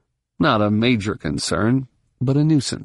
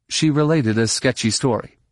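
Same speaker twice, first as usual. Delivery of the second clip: usual speaking voice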